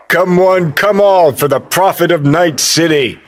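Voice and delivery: high, carrying fairground pitch; mock showman. Hyping the prophet of Night City, mock showman. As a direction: call in the crowds with mocking glee.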